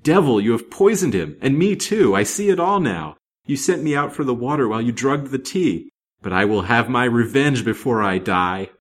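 high, loud shrieking accusation; furious despair, coming down in pitch to a vow of revenge; rage and despair. A narrator's reading. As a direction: hurl it at your poisoner. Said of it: "she shrieked in a voice of horrible rage and despair".

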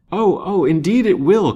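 high, loud eager outburst; eager cry. A narrator's reading. Lance eagerly assures the widow, loud and high. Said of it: "cried Lance eagerly".

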